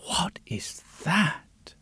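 slow, hushed whisper to herself; wondering whisper. Acted. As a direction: breathe the question, slow and secret.

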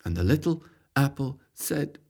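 plain reading voice

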